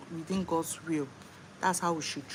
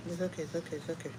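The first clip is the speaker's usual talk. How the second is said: soothing; 6 dB quieter, much faster than usual. Real, not acted. Mother soothing her crying baby, soft hush.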